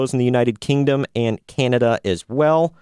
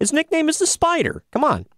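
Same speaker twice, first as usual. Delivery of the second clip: much higher, pleading protest; high exasperated protest. Real, not acted.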